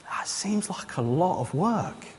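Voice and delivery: slow, soft, reluctant reply; doubtful fisherman. Acted. Fisherman answers the businessman's big plan, slow and doubtful. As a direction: weigh it slowly and quietly, unconvinced.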